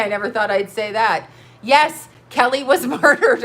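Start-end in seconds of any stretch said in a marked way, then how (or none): 1.6-3.4 laughing. Real, not acted. Laughing while making wild claim.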